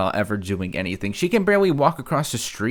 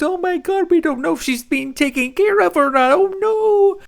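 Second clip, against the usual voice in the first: much higher, louder mock panic; mock panicked viewer; 6 dB louder than usual. Host imitating fans panicking over no updates, shrill mock panic. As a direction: ham up the worried fans, high and loud.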